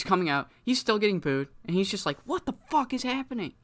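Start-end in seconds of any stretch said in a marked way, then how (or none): 2.2-3.5 high, held-back yell, softer in volume; high-pitched bewildered shout, kept quiet; mock distant yell. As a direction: pretend to yell from far away. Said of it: "he's yelling this".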